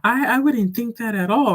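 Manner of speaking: high, loud mock-flustered denial. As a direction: imitate a flustered waitress hurrying to deny it. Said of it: "she got flustered".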